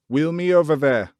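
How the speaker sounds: high, loud order; boy ordering Dickon about; imperious command. A narrator's reading. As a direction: command like a little prince. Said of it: "he commanded".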